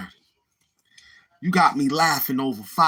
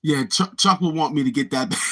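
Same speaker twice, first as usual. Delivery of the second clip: usual speaking voice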